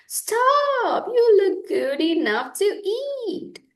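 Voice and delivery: put-on very high girlish cry; little girl. A narrator's reading. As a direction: squeal it like an eager little girl. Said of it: "said the little girl".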